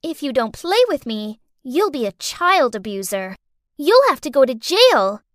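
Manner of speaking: put-on smug kid blackmailing; bratty child voice.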